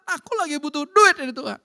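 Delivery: high, loud acted prayer; hammed-up urgent plea; pleading with God. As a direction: cry out the need to God.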